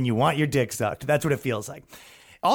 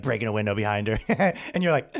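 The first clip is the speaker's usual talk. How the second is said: ordinary delivery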